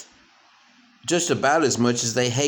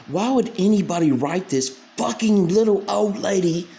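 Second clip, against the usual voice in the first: usual speaking voice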